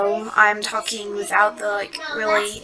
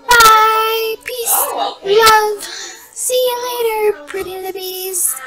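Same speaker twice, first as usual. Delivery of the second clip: very high, drawn-out goodbyes; high-pitched, stretched-out vlogger outro; singsong farewell; 10 semitones higher, 8 dB louder, much slower than usual. Pretend. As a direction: squeal a playful sing-song sign-off. Exaggerated singsong sign-off to viewers.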